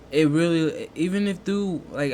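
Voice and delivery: ordinary talk, nothing marked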